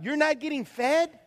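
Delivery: normal talking voice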